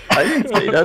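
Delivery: laughing denial. Real, not acted. Player denying the romance line, laughing. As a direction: protest it while cracking up.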